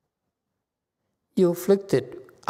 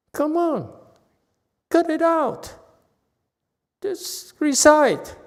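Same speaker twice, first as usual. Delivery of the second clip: high, slow, firm command to stop; stern scolding; 10 semitones higher than usual. Real, not acted. Master ordering students to just recite, high and stern. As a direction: cut them off and order them back to reciting.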